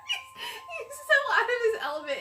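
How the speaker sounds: high, squeaky talk through laughter; amused laughing. Real, not acted. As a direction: laugh helplessly while commenting. Amused by a character.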